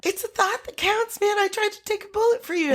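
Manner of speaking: very high, fast plea; mock protest. Movie character defending his failed rescue, in high mock protest. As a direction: protest quickly in a high put-on voice.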